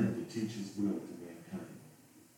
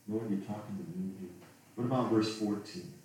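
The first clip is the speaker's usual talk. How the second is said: usual speaking voice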